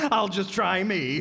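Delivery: ordinary talk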